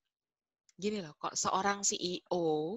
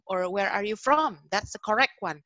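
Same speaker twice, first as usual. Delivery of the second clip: louder, higher mock pedant; grammar Nazi; 4 semitones higher, 9 dB louder than usual. Grammar stickler handing a learner the correction, loud and emphatic. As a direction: insist on the correct version. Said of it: "terus di grammar Nazi ini nanti".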